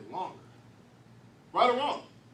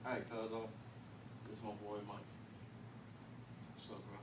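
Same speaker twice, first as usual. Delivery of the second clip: lower, softer homie introduction; low street voice; 9 semitones lower, 12 dB quieter than usual. Retold or imitated. O G introducing Mike to his boy, low and easy. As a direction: lay it back like a cool older guy. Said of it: "O G introducing Mike".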